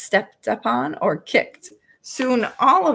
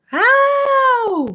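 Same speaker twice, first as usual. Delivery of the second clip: huge high roar of pain, much louder; 8 dB louder than usual. A narrator's reading. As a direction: bellow out the pain like a giant. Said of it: "roared the flesh lump eater".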